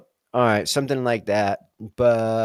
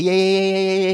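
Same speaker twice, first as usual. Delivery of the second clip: much higher, sing-song string of yeahs; mock placating; 9 semitones higher, 4 dB louder than usual. To someone upset, a much higher dismissive yeah-yeah. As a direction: brush them off with high yeahs. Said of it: "I usually make it worse by doing like".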